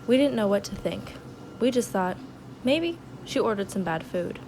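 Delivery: hushed storyteller voice; dramatic narration. Acted. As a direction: narrate it like a soap opera. Quiet dramatic reenactment narration.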